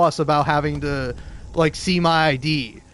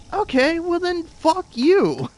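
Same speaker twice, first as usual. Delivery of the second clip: spiteful retort; 13 semitones higher, slower than usual. Retold or imitated.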